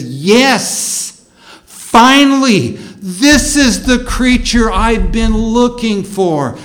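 elated relief. Acted. First man on meeting the woman, overjoyed and relieved. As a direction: build from a triumphant yes, then savor the last phrases slowly. Acts Adam's joy on seeing woman.

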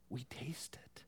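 hushed, breathy whisper, quick. Acted. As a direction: lean in and whisper it like a secret. Preacher whispering for emphasis.

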